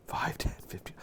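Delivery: breathy whisper, no voice at all; whispered counting. Acted. Host whispering the random numbers to viewers, hushed.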